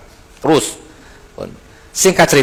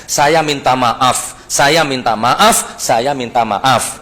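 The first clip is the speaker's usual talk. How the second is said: slow earnest apology; much slower than usual. Retold or imitated. Repeated apology to Banser, slowly.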